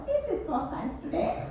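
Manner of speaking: soft, squeaky chipmunk whisper; a light chipmunk voice, hushed. Shown as a demonstration. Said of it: "whisper in a chipmunk voice".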